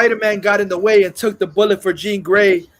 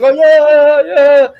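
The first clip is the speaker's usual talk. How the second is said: higher, loud excited cheer; cheering crowd; 6 semitones higher, 10 dB louder, much slower than usual. Retold or imitated. Crowd cheering the fake Spider-Man, loud and high. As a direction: whoop it up like the fans.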